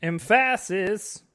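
"Emphasis" slow, stress on the last syllable; wrong emphasis. Pretend. Host echoing cohost's joke, mock wrong stress. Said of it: "incorrect emphasis on words".

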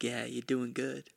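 slow, soft smooth repeat; lightly voiced creepy smooth coworker; smooth creepy echo. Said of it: "He's like".

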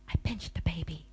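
confessing in an unvoiced whisper. Real, not acted. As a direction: breathe it like a guilty secret. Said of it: "I can't even say that aloud".

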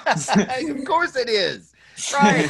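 high, slow words through laughter; laughing. Real, not acted. Agreeing with his friend about the seizure, laughing.

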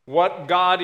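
ordinary delivery

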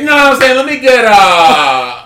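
loud, high, drawn-out ordering voice; exaggerated put-on voice, stretching the hesitation; mock soul food customer. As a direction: ham up a customer placing an order. Imitating friend ordering at soul food kitchen.

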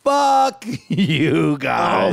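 drawn-out shouted curse; re-enacted heckle from the stage, very slow; retold outburst. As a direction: stretch each word as you yell at the crowd. Re-enacts stopping show to curse crowd.

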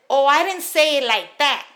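loud retold protest; defensive. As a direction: act out someone backpedaling loudly. Acting out boss backing off.

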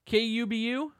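station ID read out loud and bright; announcer voice. Acted. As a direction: announce the call letters like a DJ. Radio station ID call.

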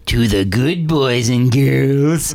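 put-on slow, sinister old man; evil grandpa warning. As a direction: drawl the threat out to terrify the boy. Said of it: "just the evilest grandpa ever".

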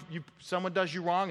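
normal talking voice

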